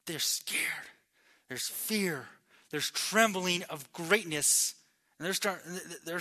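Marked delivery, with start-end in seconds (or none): 0.1-2.3 breathy near-whisper; hushed emphasis. Real, not acted. Preacher describing the frightened disciples, hushed emphasis. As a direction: let the words drop into a whisper.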